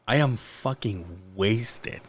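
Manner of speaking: slow, low, drawn-out admission; slow and heavy on each word; retold drunken confession.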